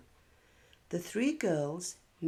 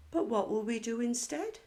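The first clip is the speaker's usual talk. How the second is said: put-on higher, quicker little-girl voice; bright child's question, higher and faster; little Katie; 6 semitones higher, faster than usual. Katie asks Grandma, child voice.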